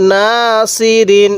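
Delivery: নাসিরিন read very slowly, syllable by syllable; slow recitation. Shown as a demonstration. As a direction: draw out নাসিরিন with one alif on না. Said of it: "কিভাবে পড়ব".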